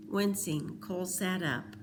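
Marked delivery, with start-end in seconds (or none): none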